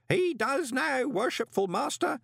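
very high, happy ceremonial call; old postman calling gladly up to his master; happy shout. A narrator's reading. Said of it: "shouted Groat happily".

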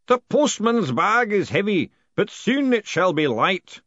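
slow, high, loud intonation; drawn-out ceremonial boom; echoing ritual voice. A narrator's reading. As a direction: intone it grandly to fill a hall. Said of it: "echoed off the walls".

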